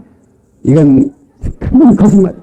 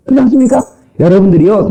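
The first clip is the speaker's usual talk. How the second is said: ordinary talk, nothing marked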